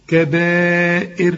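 "كبائر" said slowly with the lips held in a smile to the end; smile shape. Shown as a demonstration. Teacher showing the student how to end "كبائر" with a smile. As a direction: keep smiling through "كبائر" until the sound fades. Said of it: "رجع شفايفك على هيئة ابتسامة".

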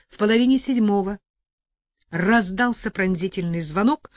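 ordinary delivery

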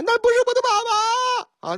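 put-on high, tearful child's voice; comic child voice sobbing at the guard; crying little kid. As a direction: play a sobbing small boy.

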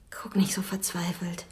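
soft comforting whisper; tender whisper. Acted. Woman comforts despairing Frank in a soft whisper. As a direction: hush his worry softly.

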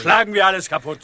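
loud, high threat to smash everything; rebellious sailor threatens the admiral, loud and angry. Acted. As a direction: bark it out in defiance.

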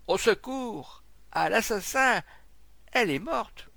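high, loud alarmed shouts; cry for help. A narrator's reading. Man back from the house to the crowd, alarmed shouting. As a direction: raise the alarm at full voice. Man cries murder after discovery.

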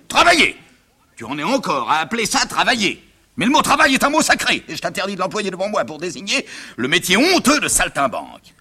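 high, indignant father lecturing; outraged tirade. Acted. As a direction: explode at the word, then rail on. Father rages at acting.

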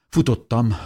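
ordinary delivery

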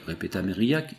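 ordinary delivery, nothing marked

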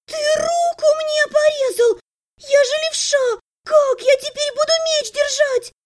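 very high, whiny protest; outraged complaint. A narrator's reading. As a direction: wail at your brother in high pitch. (возмущённо, очень высоким голосом)

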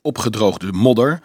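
ordinary delivery

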